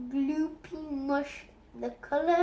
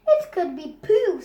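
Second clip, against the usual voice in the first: cheeky suggestion; 4 semitones higher, 7 dB louder than usual. Acted. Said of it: "said Bertie unexpectedly".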